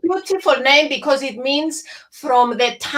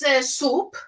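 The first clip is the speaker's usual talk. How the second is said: ordinary delivery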